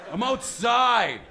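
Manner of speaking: put-on slow, loud slurred teen drawl; dopey teen giving directions lazily; slow teenager. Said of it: "I can't understand him".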